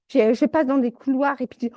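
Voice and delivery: ordinary delivery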